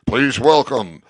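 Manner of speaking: loud, booming announcer voice building to a slow finish; club announcer. Retold or imitated. Introducing the blues legend at the club, booming announcer. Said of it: "club brings them out".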